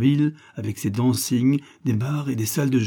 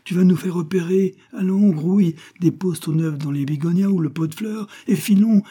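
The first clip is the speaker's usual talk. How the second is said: high, loud hurried urging; urgent urging; 8 semitones higher, 5 dB louder than usual. A narrator's reading. Little rooster urging the hen to flee, high and loud. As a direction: push her to hurry, now.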